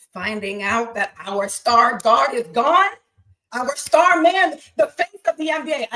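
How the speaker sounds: loud, worked-up sports fan rant; upset and mad. Real, not acted. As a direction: let the anger about the news burst out. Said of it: "I'm so mad right now".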